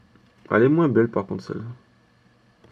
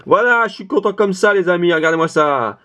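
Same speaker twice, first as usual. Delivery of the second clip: higher, beaming with joy; audibly happy; 7 semitones higher than usual. Shown as a demonstration. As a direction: let them hear the happiness. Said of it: "Ça s'entend ou pas?".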